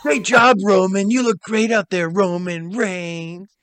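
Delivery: high, rough praising imitation of Triple H; Triple H swallowed glass voice. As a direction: praise like a proud wrestling boss. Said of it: "sounds like he swallowed glass".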